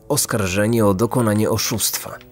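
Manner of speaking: plain reading voice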